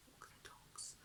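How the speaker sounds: whispering. Real, not acted. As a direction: whisper the forbidden word walk. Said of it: "I can't say that word in this house".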